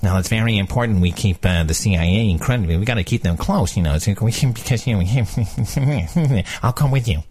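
low-pitched mock political adviser; Valerie Jarrett impression. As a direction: drop your voice and trail into mumbled counsel. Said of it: "there's Valerie Jarrett, like".

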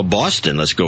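normal talking voice

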